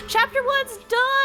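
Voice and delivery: high, slow delighted announcement; gasp, then announce it happily; excited. Real, not acted. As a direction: player celebrating a finished chapter. Finishing a chapter.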